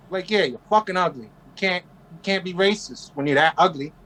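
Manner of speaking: ordinary delivery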